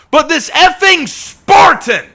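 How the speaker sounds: high, loud, drawn-out cry of outrage; exasperated outburst. Real, not acted. Ranting to fellow players about the knights, exasperated outburst. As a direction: stretch it out in loud, high-pitched indignation.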